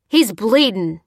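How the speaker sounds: loud, alarmed yell. A narrator's reading. Bearded man to the lieutenant, yelled. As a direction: shout the alarm. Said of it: "yelled the bearded man".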